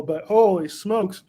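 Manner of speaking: barely able to talk from heat; mouth burning from spicy food. Real, not acted. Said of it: "I can barely talk at the moment".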